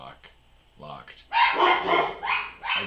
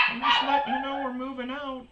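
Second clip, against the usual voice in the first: mock old woman. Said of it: "And she goes".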